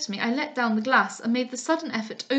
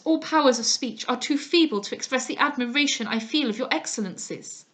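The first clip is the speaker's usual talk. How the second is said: plain reading voice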